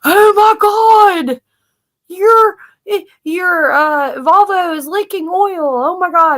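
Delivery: high, slow, frantic mock panic; hammed-up alarm over a leak; panicky sister. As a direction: imitate a sister freaking out. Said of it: "She's like".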